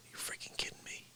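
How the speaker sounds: barely audible, voiceless grumble; whispered disbelief. Real, not acted. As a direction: whisper it to yourself in frustration. Muttering at failed playback.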